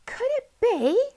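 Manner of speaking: very high, hushed hope; hardly daring to believe. A narrator's reading. Polly sees the dog coming, high and disbelieving. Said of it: "hardly daring to believe".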